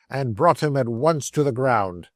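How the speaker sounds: plain reading voice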